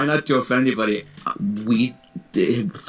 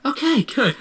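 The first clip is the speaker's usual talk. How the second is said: high, brisk satisfied okay; mock breezy teacher; 12 semitones higher than usual. Teacher to class, mock breezy and high. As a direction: brush it off cheerfully.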